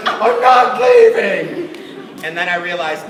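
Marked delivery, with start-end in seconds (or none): 0.1-1.7 loud, high, drawn-out mock wail; hammed-up strained refusal; desperate defiant yell. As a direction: imitate a kid enduring agony, yelling slowly. Kid suffering but refusing to leave.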